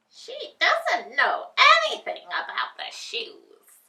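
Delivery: high, loud witchy glee; high gleeful witch voice. A narrator's reading. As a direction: gloat like a scheming witch. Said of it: "the witch thought gleefully".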